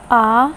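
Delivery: आ held long and open; long आ for the class; long vowel demo. Shown as a demonstration. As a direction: sound out a drawn-out आ. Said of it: "जैसे".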